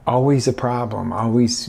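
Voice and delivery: ordinary talk, nothing marked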